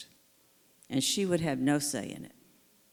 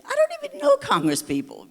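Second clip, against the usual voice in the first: very high, louder whiny protest; helpless protest; 8 dB louder than usual. Retold or imitated. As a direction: squeal it out, overwhelmed. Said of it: "I say".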